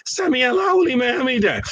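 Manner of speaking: high imam-style recitation of the wife; mock high voice chanting the prayer call; wife leading the prayer, imitated high. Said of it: "and she's like".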